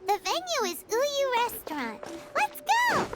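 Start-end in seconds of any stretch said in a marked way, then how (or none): 2.4-3.1 bright high call to set off; rally everyone, let's go; eager high cheer; excited cheer. Acted.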